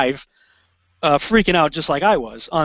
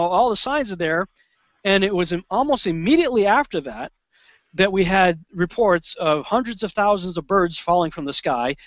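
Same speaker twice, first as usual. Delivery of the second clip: usual speaking voice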